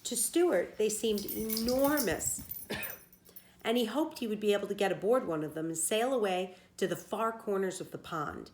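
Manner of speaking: plain reading voice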